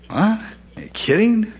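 high, startled "are you kidding?"; incredulous surprise. Retold or imitated. Preacher imagining his own stunned reaction, incredulous. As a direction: blurt it out in disbelief. Mock disbelief at being loved.

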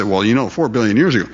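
quick, offhand mimicry of people; casual parroting. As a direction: toss it off as common knowledge. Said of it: "People just say".

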